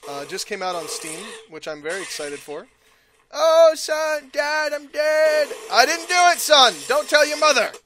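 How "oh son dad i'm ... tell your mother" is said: mock guilty dad. Voices father who killed son.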